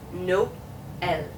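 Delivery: "no ... el" in two detached syllables; stop and start. Shown as a demonstration. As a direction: break "no, el" with a silence between. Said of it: "makes it stop and start".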